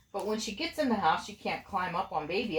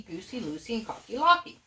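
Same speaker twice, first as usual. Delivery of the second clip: usual speaking voice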